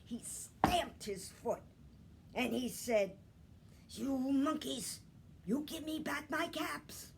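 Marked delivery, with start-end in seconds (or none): 3.9-7.1 mildly angry peddler. Acted. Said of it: "Now he felt quite angry".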